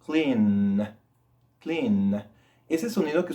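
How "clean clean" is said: "Clean" with a held, humming N; vibrating N. Shown as a demonstration. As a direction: let the N ring at the end of "Clean". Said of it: "estoy produciendo un sonido".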